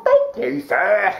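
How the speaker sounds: ordinary talk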